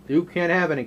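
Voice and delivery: ordinary talk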